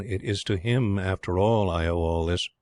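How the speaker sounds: usual speaking voice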